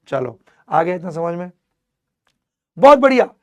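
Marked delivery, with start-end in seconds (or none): 2.8-3.3 high and loud, bursting with energy; energetic teacher's cheer; loud praise. Real, not acted. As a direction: shout the praise to wake the class.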